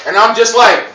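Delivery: ordinary talk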